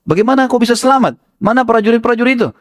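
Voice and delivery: high, amazed questions; astonished crowd. Acted. Townsfolk amazed to see Waddah alive, high astonished calling. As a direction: call out in disbelief.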